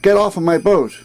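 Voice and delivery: a narrator's restrained yell; mild yell. A narrator's reading. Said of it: "was to yell".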